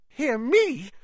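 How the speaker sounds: very high, loud panicked squeak; unnaturally high voice. A narrator's reading. Ron terrified in the dark, unnaturally high voice. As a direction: shoot the voice right up in fright. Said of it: "in an unnaturally high voice".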